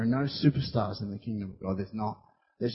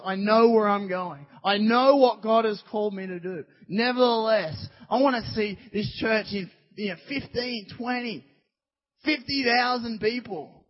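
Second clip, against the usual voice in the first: high, insistent sermon voice; raised preacher's voice, stretching the key words; impassioned preaching; 8 semitones higher, 4 dB louder than usual. Acted. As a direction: drive the vision home to the congregation. Preaches at full force.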